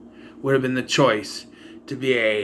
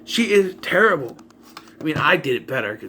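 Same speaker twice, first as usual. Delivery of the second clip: usual speaking voice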